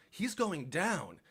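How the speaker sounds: He's going down, drawn out slowly and heavily; slow dramatic emphasis. A narrator's reading. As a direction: let "going down" land slow and ominous. Dramatic slow stress on descent.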